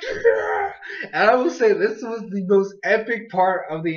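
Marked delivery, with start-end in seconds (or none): 0.0-0.7 excited cheer. Retold or imitated. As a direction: cheer like your team just scored. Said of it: "And I was just like".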